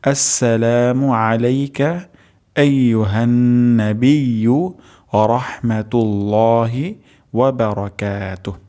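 the whole phrase recited slowly and clearly; slow full recitation. Shown as a demonstration. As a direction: recite the greeting slowly, syllable by syllable. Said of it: "উচ্চারণটি আরেকবার করছি".

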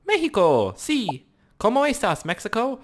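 put-on high, playful Mexican-accented greeting; mock Mexican accent. Gamer greeting Mexico player, mock Mexican accent. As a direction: call out in a hammed-up Mexican accent.

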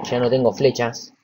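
normal talking voice

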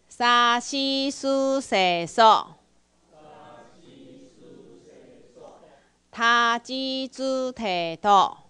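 each syllable drawn out, loud and slow; slow loud drill. Shown as a demonstration. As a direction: lead the class in a slow chant. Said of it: "好，再一遍好不好？". (大声慢速带读)

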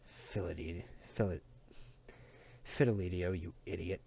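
understated exasperated groan. A narrator's reading. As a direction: groan it wearily at a friend. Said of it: "groaned Chacho".

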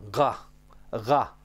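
low guttural "Ga", not a Romanian G; throaty G. Shown as a demonstration. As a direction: say "Ga" from the back of the throat. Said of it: "G nu este G-ul ca în română".

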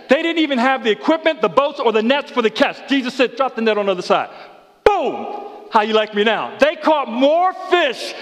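high, loud, fired-up preacher calling out to the room; full-voiced revival sermon, pitched high and loud; preaching at full force. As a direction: preach it big, lifting the whole congregation. Preacher hypes the miraculous catch.

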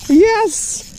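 a very high cheer; triumphant yell. Real, not acted. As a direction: shout it in triumph. Cheering while fighting a fish.

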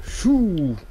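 high, loud shoo; shooing the monster away. Real, not acted.

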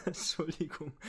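apologizing through a laugh; laughing apology. Real, not acted. GM laughs while apologizing to the player. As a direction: laugh it out, breaking character.

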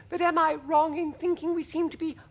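very high-pitched, polite questioning; a tiny, high ladybird voice; timid ladybird. Acted. Ladybird asking if they are bobbing, high timid voice. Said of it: "murmured the ladybird".

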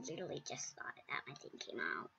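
fast breathy whisper. Real, not acted. Kid whispering that her headset almost fell off. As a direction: murmur it in relief. Relieved aside.

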